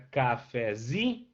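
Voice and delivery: cafezinho split into syllables, ZI drawn out; stress on ZI. Shown as a demonstration. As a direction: break up cafezinho and land on the zi. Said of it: "a sílaba tônica vira zi".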